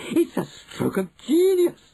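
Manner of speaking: very high, loud admiring squeal; crying out. A narrator's reading. Witches praising their leader, excited cry. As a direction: gush at the top of your range. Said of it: "they cried".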